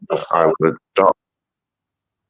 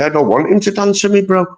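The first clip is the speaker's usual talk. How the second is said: mock scared reply; 13 semitones higher than usual. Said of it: "I was gonna say".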